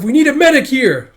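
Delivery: loud, high cry for help; urgent shout. Acted. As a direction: yell across the battlefield for help. Calling for medic in battle.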